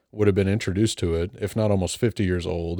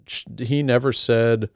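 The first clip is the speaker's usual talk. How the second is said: usual speaking voice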